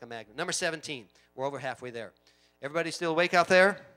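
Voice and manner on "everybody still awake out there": loud, quick call to the congregation; rousing, louder check-in. Real, not acted. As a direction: call out to wake the room.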